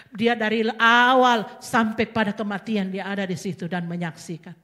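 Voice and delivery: ordinary delivery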